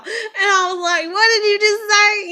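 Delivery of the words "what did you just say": high, loud disbelieving question; incredulous outburst. Retold or imitated. Teller to classmates after their remark, loudly incredulous. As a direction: fire it back in amused shock. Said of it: "I busted out laughing".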